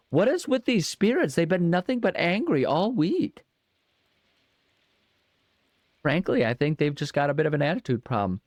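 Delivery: very high, exasperated whine; high squeaky complaint. Pretend. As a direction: squeak it out in disbelief. Complaining about spirits in high voice.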